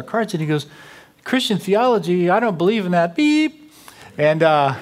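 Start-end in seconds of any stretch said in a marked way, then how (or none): 1.2-3.1 mock a skeptic's incredulous comeback; scoffing retort. Retold scoffing reply of sports fan. 3.1-3.7 high-pitched imitated bleep; censor beep. Censors the man's swear word.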